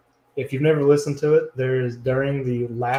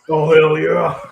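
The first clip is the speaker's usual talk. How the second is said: loud, higher laughing wrestler yell; Stone Cold impression; 4 semitones higher, 7 dB louder, slower than usual. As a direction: roar it like a pro wrestler on the mic. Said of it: "Give me a hell yeah".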